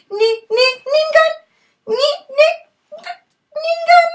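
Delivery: very high sing-song name call; shrill teasing snake call. Acted. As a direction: squeal her name very high, mocking. Snake call while she cries.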